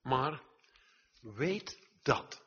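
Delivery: firm, forceful declaration; loud and clear. Real, not acted. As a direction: drive each word home, loud and high. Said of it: "ik zeg het nou hard en duidelijk".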